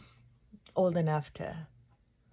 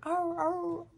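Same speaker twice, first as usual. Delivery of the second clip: crow call imitation; 11 semitones higher than usual.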